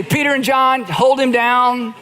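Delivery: playful mock order; mock command. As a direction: bark it out as a joke. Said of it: "Did he say".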